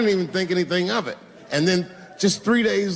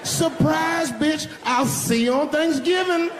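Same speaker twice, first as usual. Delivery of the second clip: gleeful taunt; 12 semitones higher, slower than usual. Acted. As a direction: shout the gotcha at her. Taunting punchline, shouted.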